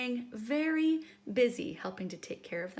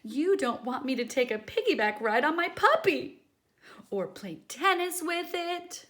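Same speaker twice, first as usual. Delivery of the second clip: louder complaining pigeon; whiny pigeon; 6 semitones higher, 8 dB louder than usual. Acted. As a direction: plead your case as the bird.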